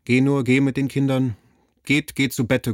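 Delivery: put-on insistent, sending the children away; father urging the mother to take the children to bed; urgent command. Father urgently sends family away.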